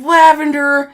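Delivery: high, loud call for help; imitated urgent shout. Partner shouting to a fellow officer for help, urgent yell. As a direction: shout the order across.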